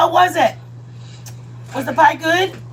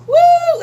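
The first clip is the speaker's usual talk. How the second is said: high, loud whoop of joy; delighted whoop; excited woo; 8 dB louder than usual. Real, not acted. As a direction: cheer a friend in. Cheers viewer arriving.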